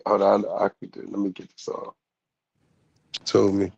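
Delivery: ordinary talk, nothing marked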